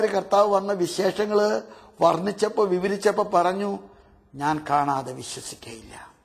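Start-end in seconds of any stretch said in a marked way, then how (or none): 4.3-6.1 doubting Thomas. Acted.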